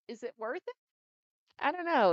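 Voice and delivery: exasperated. Real, not acted. Exasperated over hustle.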